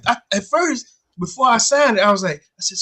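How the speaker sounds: ordinary talk, nothing marked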